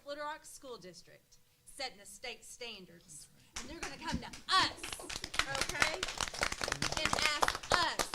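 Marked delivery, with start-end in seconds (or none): 3.6-8.1 loud, high and passionate; rising emotion. Real, not acted. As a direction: build to a fervent climax. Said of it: "y'all excuse me while I'm getting emotional".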